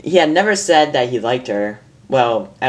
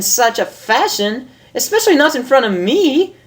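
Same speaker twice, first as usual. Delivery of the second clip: much higher, amused lady unicorn voice; a refined mare teasing her friend, higher; Rarity; 10 semitones higher than usual. Acted. Reads Rarity's lines in voice.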